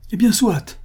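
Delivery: sudden high-pitched cry; narrator's acted outburst, pitch leaping up; exclaiming. A narrator's reading. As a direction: cry out in reckless surrender. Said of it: "s'écria-t-il".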